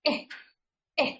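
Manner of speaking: eh with the cheeks lifted a little; eh, short and open; short e demo. Shown as a demonstration. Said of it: "the cheek has to go little up".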